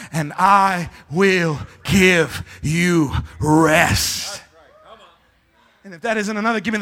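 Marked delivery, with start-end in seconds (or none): 0.1-4.4 very slow, each word stretched; drawn-out promise. Acted. Preacher quoting Jesus's promise of rest, drawn out. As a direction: linger on every word of the promise.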